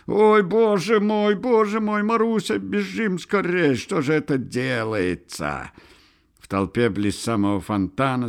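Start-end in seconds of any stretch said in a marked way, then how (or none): 0.1-5.7 high, wailing panic; high voice sinking into a fearful lament; alarmed cry. A narrator's reading. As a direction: fret aloud and urge someone to flee. Frightened bystander urging flight.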